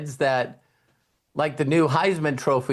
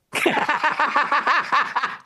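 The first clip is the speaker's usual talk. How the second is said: real high laugh; high delighted laugh; 12 semitones higher than usual. Real, not acted. Speaker laughs at his own punchline, high and delighted.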